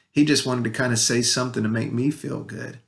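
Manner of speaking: usual speaking voice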